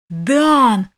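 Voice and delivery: loud, drawn-out call; long cry. A narrator's reading. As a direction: call out across the field. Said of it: "протяжный крик".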